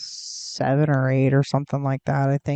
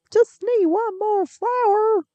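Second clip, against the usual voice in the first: much higher, slower, cartoonish squeak; a silly high-pitched plea for one more flower; squeaky high put-on voice; 3 dB louder than usual. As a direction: stretch it out in a tiny high voice. Repeating line about the last flower.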